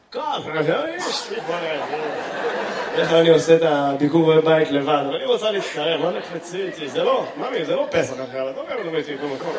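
קול נמוך מעט של זקן, חיקוי קליל; מגלם בקלילות את הזקן שמדבר עם אשתו. Retold or imitated. The comic voices the old man. (חיקוי קליל של זקן מדבר אל אשתו)

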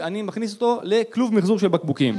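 ordinary talk

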